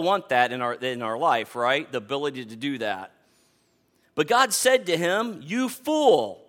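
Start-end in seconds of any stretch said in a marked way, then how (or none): 5.4-6.4 a firm, emphatic "You fool.". Shown as a demonstration. Pastor voicing God's rebuke in the parable, emphatic. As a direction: land "fool" hard and final. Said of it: "And it's real emphatic".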